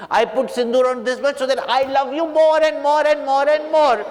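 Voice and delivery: high, plaintive outburst; upset protest. Acted. Hanuman tells Ram why he wore sindoor, high plaintive protest.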